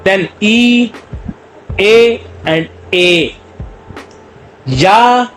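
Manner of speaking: ई, ए and या sounded out slowly; vowel demo. Shown as a demonstration. Teacher sounding vowels for students, slow and drawn out. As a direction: drag out each vowel for the class.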